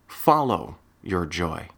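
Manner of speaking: slow, with weight on "your" in "Follow your joy"; stress on your. Shown as a demonstration. Advising a listener, stressing "your" in "Follow your joy". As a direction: lean on "your", say it slowly. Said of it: "Emphasis on the word your".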